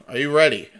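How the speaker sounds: ordinary delivery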